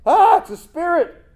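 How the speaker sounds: high mock-frightened yelp; hammed-up spooked disciple; scared cry. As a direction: squeal it like a startled fisherman.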